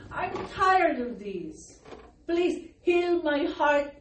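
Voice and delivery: slow, drawn-out pleading, re-enacted; retold tearful prayer. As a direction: act out a weary plea for healing. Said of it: "I cried to God and I said".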